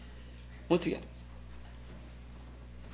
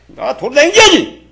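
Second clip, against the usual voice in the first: loud, slow, high exhortation; real scolding; 8 semitones higher, 11 dB louder, slower than usual. Real, not acted. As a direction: urge them out with a drawn-out shout. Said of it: "그래서 지금 호통치는 거예요".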